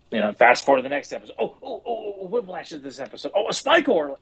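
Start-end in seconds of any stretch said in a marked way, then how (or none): 1.4-4.2 high, excited discovery; thrilled to find him. Retold or imitated. Excited kid finding episode.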